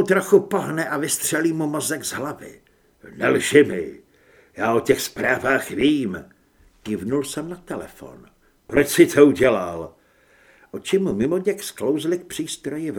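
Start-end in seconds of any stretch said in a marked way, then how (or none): 3.2-6.2 loud, hard accusation; angry confrontation. A narrator's reading. Narrator confronts Grant over messages. 8.7-9.9 loud, low-pitched question; angry demand. A narrator's reading. Narrator confronts traitor at gunpoint.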